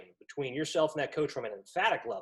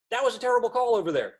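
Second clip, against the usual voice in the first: mock angry coach, higher, louder and fast; hammed-up complaint about a terrible call; yelling coach; 8 semitones higher, 6 dB louder, faster than usual. Coach yelling at the referee about a terrible call.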